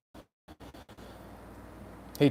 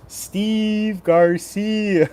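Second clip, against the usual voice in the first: announcer callout; 9 semitones higher, 6 dB louder, much slower than usual. Acted. Hyped name callout.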